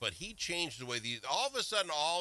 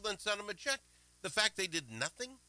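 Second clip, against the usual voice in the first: ordinary talk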